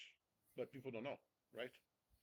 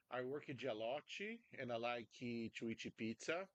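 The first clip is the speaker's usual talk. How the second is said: English with a heavy Brazilian accent, extra vowels on word endings; exaggerated Brazilian-accented English; thick Brazilian accent. Shown as a demonstration. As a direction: demonstrate a hard-to-understand Brazilian accent. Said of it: "you gotta have an understandable accent".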